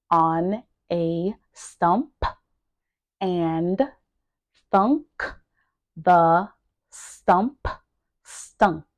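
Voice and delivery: isolated words, long pauses between. Shown as a demonstration. Teacher drilling a tongue twister for learners, word by word. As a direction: pause after each word, pronouncing it carefully. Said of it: "make sure that you are pronouncing each word".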